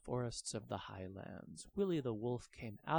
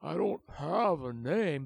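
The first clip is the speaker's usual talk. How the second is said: louder, slow sad admission; glum dragon; 8 dB louder, slower than usual. Acted. The dragon telling Francesca, slow and glum. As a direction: say it sadly.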